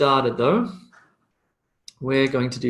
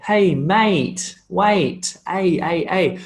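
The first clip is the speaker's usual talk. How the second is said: higher, broad Australian vowel glides; Australian accent; 4 semitones higher, much slower than usual. Shown as a demonstration. Teacher showing a sound Australians say, Australian accent demo. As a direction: play up the Australian A in mate. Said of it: "funny sound for Australians to say".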